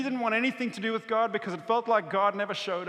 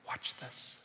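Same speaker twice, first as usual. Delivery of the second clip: whispered aside as Jesus; conspiratorial whisper; 14 dB quieter than usual. Acted. Jesus to a little boy before the miracle, whispered. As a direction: let them in on it in a whisper.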